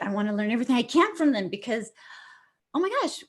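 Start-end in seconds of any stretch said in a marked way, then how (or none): 2.7-3.2 high, thrilled oh my gosh; a sudden high squeal of delight; excited gasp. Real, not acted. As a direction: burst out in delight. Excited exclamation about learning.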